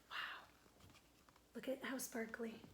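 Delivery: soft, awed near-whisper; hushed wonder. Real, not acted. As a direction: marvel quietly at the picture. Awed aside over the picture.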